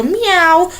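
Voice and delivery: high, cute mock meow; imitating a cat meow. Imitating girlfriend Helen meowing on the phone. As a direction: meow like a kitten. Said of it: "she'll just go".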